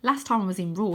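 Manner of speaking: ordinary delivery, nothing marked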